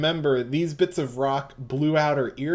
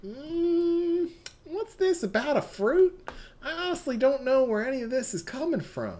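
Southern Belle; 9 semitones higher than usual. Acted. GM voicing Mitsuba to Yasuho as a sweet Southern belle. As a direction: play the genteel Southern lady, all honey. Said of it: "doing her Southern Belle".

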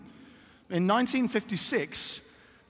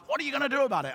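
mock angry demand; 7 semitones higher, much faster than usual. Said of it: "very angry passengers saying".

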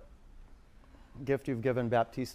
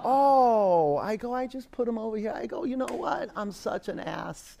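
sheepish self; 9 semitones higher, 4 dB louder than usual. Retold or imitated. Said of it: "And I go".

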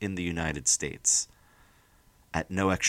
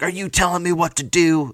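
put-on high, loud, dopey Boston accent slipping into Southern; silly Boston guy; 11 semitones higher, 6 dB louder than usual. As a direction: play an indignant sketch Bostonian, badly. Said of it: "like, a Boston guy".

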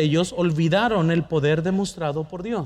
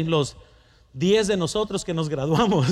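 usual speaking voice

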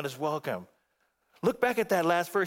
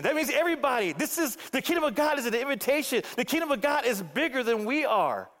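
ordinary talk, nothing marked

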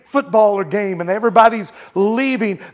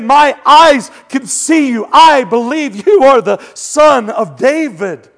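emphatic declaration; 4 semitones higher, 6 dB louder than usual. Retold or imitated. Preacher voices blind man's profession.

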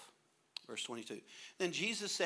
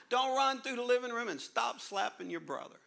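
much higher, louder parent barking orders; loud high nagging parent; 9 semitones higher, 5 dB louder than usual. Pretend. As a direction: yell the chores like a frazzled parent. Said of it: "tip of the day".

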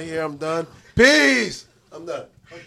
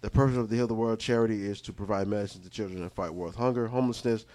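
mock charity appeal; 11 semitones lower, 8 dB quieter than usual. Giving his final thoughts as a solemn charity pitch. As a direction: lay it on like a charity ad.

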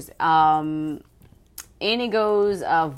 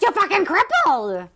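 mock nurse yell; 10 semitones higher than usual. Repeating the nurse's scream.

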